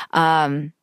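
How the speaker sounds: ordinary delivery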